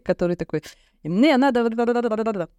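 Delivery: ordinary delivery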